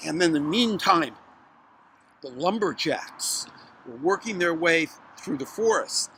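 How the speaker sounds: ordinary delivery, nothing marked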